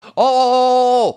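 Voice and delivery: loud, rising excited burst. Real, not acted. Streamer suddenly finding the origin server, thrilled. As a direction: react with sudden delight. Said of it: "sudden excited discovery".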